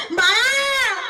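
high, drawn-out wail of a mother; desperate cry. Acted. Mother calls to her trampled baby, desperate cry. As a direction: cry out for the child. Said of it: "आईला जीवाच्या आकांताने ओरडा द्यावा".

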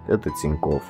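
usual speaking voice

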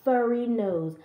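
usual speaking voice

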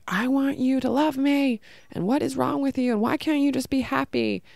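put-on retelling her father begging a child for love, pleading; imitating pleading father.

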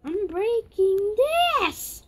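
high, drawn-out excited yell; calling out. Real, not acted. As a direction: stretch it out slowly and loudly. Calling out over the game.

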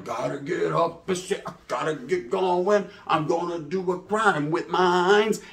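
put-on groggy old self. To himself each morning, a slow sleepy mumble. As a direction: drag the words out like just waking up.

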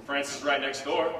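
ordinary talk, nothing marked

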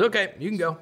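a hint of a high breezy doc; lightly voiced doctor sending the boy off; doctor dismissing. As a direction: brush him off, lightly voiced.

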